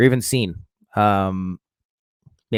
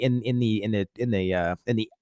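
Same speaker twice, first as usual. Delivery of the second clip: stumbling over words, repeating; stuttering; 2 semitones lower, 5 dB quieter than usual. Real, not acted. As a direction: stammer and restart. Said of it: "I'm just a stuttering fool here".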